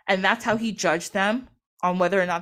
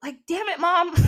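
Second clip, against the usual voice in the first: high-pitched mock outrage at Mom; super mad; 8 semitones higher than usual. Horus to his mother who pulled him out, super mad. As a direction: whine in hammed-up anger, on the edge of a laugh. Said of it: "So he's super mad".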